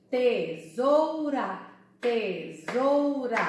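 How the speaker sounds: TESOURA broken into te, sou, ra, slowly and firmly; syllable by syllable. Shown as a demonstration. Teacher showing a three-syllable word, syllable by syllable. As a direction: sound out TESOURA loudly, pausing after each syllable. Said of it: "Tem três sílabas".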